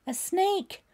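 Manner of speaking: high, loud panicky cry; a girl's voice cracking with alarm; near panic. A narrator's reading. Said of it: "on the edge of panic".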